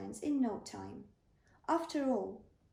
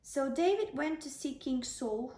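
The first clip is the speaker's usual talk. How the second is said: plain reading voice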